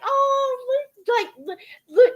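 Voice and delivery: pointing out photos with a squeal; excited gushing. Retold or imitated. Retelling how she gushed over old photos, excited. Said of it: "And I was like".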